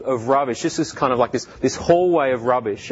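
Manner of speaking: ordinary talk, nothing marked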